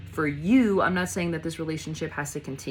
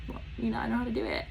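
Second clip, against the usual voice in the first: soft, higher, half-whispered humblebrag; hushed little aside, pitched up; mock modesty; 5 semitones higher, 6 dB quieter, faster than usual. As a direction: play coy, barely voiced. Voices someone modestly boasting.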